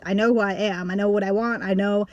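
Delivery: ordinary delivery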